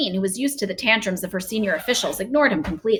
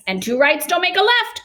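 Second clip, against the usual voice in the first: high triumphant quip; gleeful cry; 9 semitones higher, 3 dB louder than usual. A narrator's reading. BFG cheerfully tops the Queen, high gleeful cry. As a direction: crow it out with glee. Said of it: "cried the B F G".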